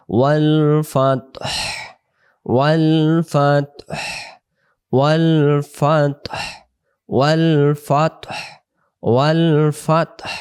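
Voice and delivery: ওয়াল ফাতহ slow with the final হ clearly breathed; final ha sounded. Shown as a demonstration. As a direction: let the হ of ফাতহ be heard. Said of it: "শেষে দেখেন একটা হায়ের উচ্চারণটা বেশি আসতেছে". (ফাতহ এর হ স্পষ্ট)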